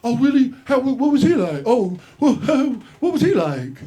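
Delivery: high, loud, eager fanboy gush; starstruck gushing. Retold or imitated. Comic to a touring headliner, high loud starstruck gushing. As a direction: gush like meeting a celebrity's friend. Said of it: "I was like".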